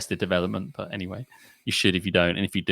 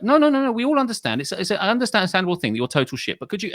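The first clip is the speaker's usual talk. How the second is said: higher-pitched hasty reassurance, mock-diplomatic; placating objection; 7 semitones higher than usual. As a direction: cut in high to smooth things over. Quoting someone placating, cutting in.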